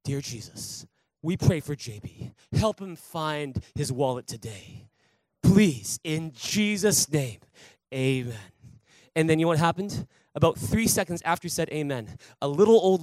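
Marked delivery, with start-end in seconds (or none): 0.0-8.4 lower, slow prayer voice; praying bandleader. Retold or imitated. Worship leader praying over the lost wallet, low and slow. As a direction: lead a slow, hushed group prayer. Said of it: "and there's like".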